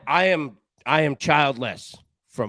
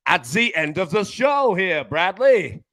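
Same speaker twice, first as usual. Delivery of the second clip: put-on louder, higher-pitched hammy German accent; badly faked German accent, slowed and loud; 5 semitones higher, 5 dB louder, slower than usual. Host signing off to Bradley in a mock German accent. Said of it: "That was the worst German accent of all time".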